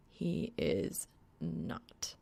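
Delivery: slow, hushed insistence; whispered denial. Acted. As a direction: whisper it slowly to yourself. Firm denial to himself, whispered.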